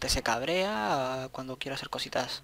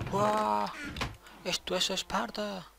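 higher, drawn-out hammed-up movie line; mock Spartan battle cry; 7 semitones higher, much slower than usual. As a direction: ham up the famous Sparta line. Movie quote while gearing up.

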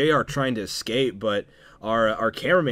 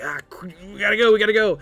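mock panic; 8 semitones higher, 5 dB louder, much faster than usual. Panicked retreat from trolls.

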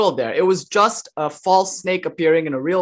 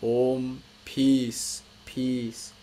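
softer, slower closing benediction fading out; solemn recitation; 3 semitones lower, 5 dB quieter, slower than usual. Acted. Teacher giving the chant's meaning as a gentle benediction, softer. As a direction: read it like a quiet blessing.

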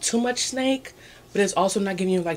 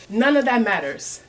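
emphatic declaration; 2 semitones higher, 7 dB louder than usual. Real, not acted. Emphatic preachy point.

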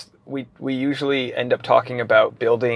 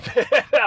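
burst of laughter; 9 semitones higher than usual. Real, not acted. Laughs at full name.

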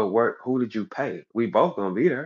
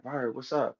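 soft, offhand greeting; unbothered; 8 dB quieter, slower than usual. Retold or imitated. Man strolling up to the speaker in the mall, unbothered. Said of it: "unbothered and shit".

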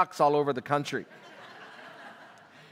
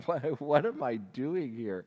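quiet bewilderment; 3 semitones lower, 6 dB quieter, slower than usual. Retold or imitated. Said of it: "I'm thinking".

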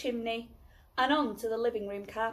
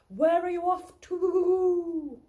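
higher, slow, louder owl; 8 semitones higher, 7 dB louder, slower than usual. Acted. Owl calling out to Baby Bear in a slow, higher voice. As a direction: draw out a curious owl's question.

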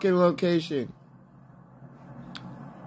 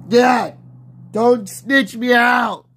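higher, loud, drawn-out yell; protesting shout; 4 semitones higher, 5 dB louder, slower than usual. Real, not acted. Player warning teammate not to snitch, loud shout. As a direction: shout the plea, stretching the words.

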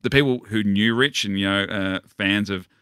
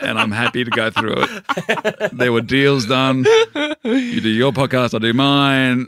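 higher and louder, slow and deliberate like a shady dealmaker; mock-tough boast; 5 semitones higher, 6 dB louder than usual. Teasing the host about a trade-off deal, mock-serious swagger. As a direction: play it as a hammed-up backroom deal.

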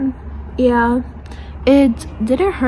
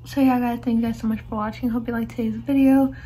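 slightly lisped speech around retainer; careful mouth movements over retainer; talking with a retainer in; 4 dB quieter than usual. Real, not acted. Seen 3: Retainer across front teeth seen throughout; she says talking is hard.

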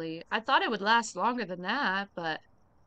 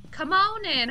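cheerful welcome; 6 semitones higher, 5 dB louder than usual. Real, not acted.

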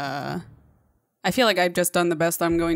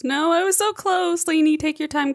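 high, pained wail of defeat; high disappointed whine; dismayed whine; 9 semitones higher than usual. Real, not acted. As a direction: moan over the near miss. Just died near record.

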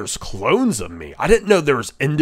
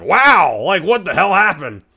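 surprised; 6 semitones higher, 5 dB louder than usual. Retold or imitated. Stunned reaction quote.

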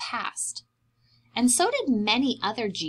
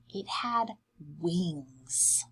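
hushed; 4 semitones lower, 7 dB quieter, much slower than usual. Acted. First quiet mention, then repeated amazed.

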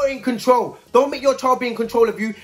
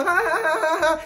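high, imitated onlookers' laugh; mocking laughter; 7 semitones higher than usual. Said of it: "they were laughing".

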